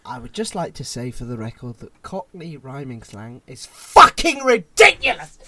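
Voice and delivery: measured start bursting into a loud shout; mock outburst. Co-host echoing the friend's verdict, composed then mock furious. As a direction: start composed, then explode on the final words. Parodies the other's closing statement.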